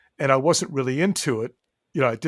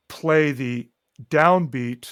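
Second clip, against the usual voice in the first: ordinary delivery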